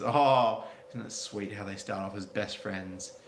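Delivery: ordinary delivery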